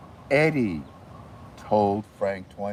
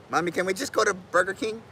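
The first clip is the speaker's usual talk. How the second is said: high, quick chick voice; the little chick complaining to its mommy; fast high chick voice; baby chick voice; 8 semitones higher, faster than usual. Pretend.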